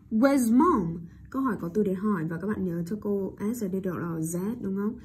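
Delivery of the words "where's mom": "where's mom?" ending on a clear fall; falling intonation. Shown as a demonstration. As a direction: drop the voice on "mom". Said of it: "Sử dụng tông giọng đi xuống". (xuống giọng ở từ "mom")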